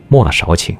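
ordinary delivery, nothing marked

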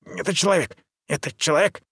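quick, high, eager ape-man babble; excited chatter. A narrator's reading. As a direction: rattle it off excitedly. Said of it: "затараторил мой проводник".

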